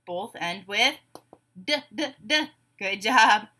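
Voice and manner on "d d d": "d" as a bare consonant, repeated; short separate taps of "d", not the letter name; isolated end sound. Shown as a demonstration. Teacher showing the end sound, isolated consonant. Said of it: "you tell me the end sound".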